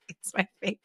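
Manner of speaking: normal talking voice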